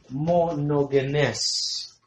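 "Monogenes" stretched very slowly, long first syllable, then the rest joined; slow sounding-out. Shown as a demonstration. Preacher repeating the Greek term for the congregation, slowly sounded out. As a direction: draw out "Monogenes" for learners, holding the last syllable.

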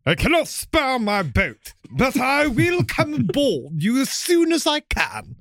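high, slow, pompous British officer put-on; hammed-up aristocratic naval captain, every word drawn out; Captain Maynard. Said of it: "Captain Maynard said".

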